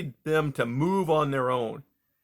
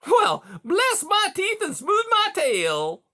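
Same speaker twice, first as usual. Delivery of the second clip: very high, slow delighted exclaim; glowing beaver; 6 dB louder, slower than usual. A narrator's reading. Beaver delighted by Rye's answer, high and glowing. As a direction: savor the good news. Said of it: "Mister Conrad glowed".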